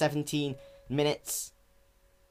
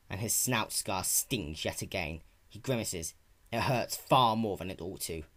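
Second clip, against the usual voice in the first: dramatic story narration; 6 semitones lower, 4 dB quieter than usual. A narrator's reading. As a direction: read it like a brooding fantasy tale. Reads his fanfiction aloud.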